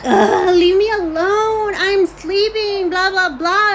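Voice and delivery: high, loud, drawn-out mock whine; exasperated groan into stretched-out complaining; angry daughter. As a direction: imitate an irritated daughter being woken up. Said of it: "and she got angry".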